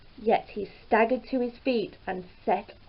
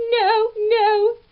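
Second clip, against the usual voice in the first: high, loud cry of the ogre's wife; shrill protest from the wife; 12 semitones higher, 9 dB louder than usual. A narrator's reading. Ogre's wife cries out to Jack, high and loud. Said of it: "she cried".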